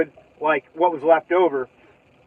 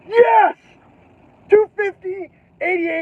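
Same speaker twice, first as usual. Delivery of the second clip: much higher, loud excited whoop; thrilled cheer; 4 dB louder, slower than usual. Retold or imitated. Scratching a winning lottery ticket, high excited cheer. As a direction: celebrate the lottery win. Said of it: "I'm like".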